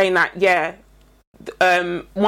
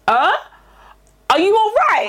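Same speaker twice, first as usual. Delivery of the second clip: mock-baffled reply to the mum; incredulous; 10 semitones higher than usual. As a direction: ask if she has lost her mind. Retorting to the mother.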